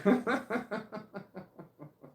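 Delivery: long laugh up high; real high laugh. Real, not acted. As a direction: let the laugh trail off. Laughs at own trauma joke.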